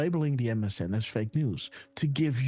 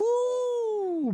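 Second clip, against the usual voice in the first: joyful whoop. Real, not acted. Reacting with joy to the date.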